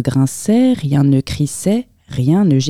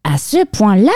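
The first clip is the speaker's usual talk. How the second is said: raised, surprised mouse voice; astonished mouse; 5 semitones higher than usual. A narrator's reading. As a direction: question it in amazement, pitched up. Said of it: "s'étonna la souris".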